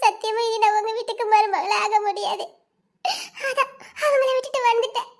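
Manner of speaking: distraught sobbing confession; tearful, gasping between phrases; crying. Acted. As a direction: pour it out through tears. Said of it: "நீ எதுக்கிட்ட அழுதுட்டு வர".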